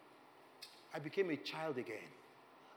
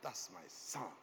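tender awe; 10 semitones lower, 7 dB quieter, much slower than usual. Retold or imitated. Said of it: "he's like".

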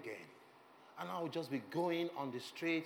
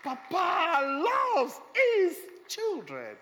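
much higher, louder and slow, a full preacher's shout; preaching shout; 10 semitones higher, 10 dB louder, much slower than usual. Real, not acted. Preacher to the congregation, a slow, full shout. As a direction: thunder it out to the whole church.

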